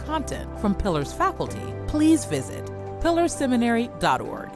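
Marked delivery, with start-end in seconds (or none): none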